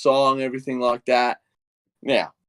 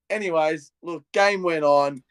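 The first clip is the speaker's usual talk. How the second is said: ordinary talk, nothing marked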